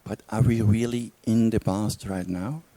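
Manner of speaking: calm, unhurried meditation guidance with long pauses; guided meditation. Real, not acted. Teacher guides listeners back through a memory, calm meditation voice. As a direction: lead them inward gently, leaving silence between phrases.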